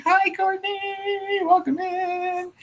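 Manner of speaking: very high, drawn-out sing-song thanks; sing-song greeting. Real, not acted. Host thanking a viewer for joining, sing-song greeting.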